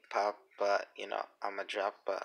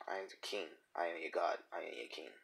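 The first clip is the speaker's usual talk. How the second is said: low, soft tyrant king declaration; roaring tyrant king; 5 semitones lower, 13 dB quieter, faster than usual. Pretend. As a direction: intone it like a self-crowned ruler. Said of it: "I'm like, "Ultra Instinct has awakened".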